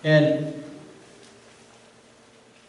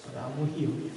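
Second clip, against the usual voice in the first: tender promise; 2 semitones lower, 13 dB quieter, faster than usual. Acted. God's promise after judgment.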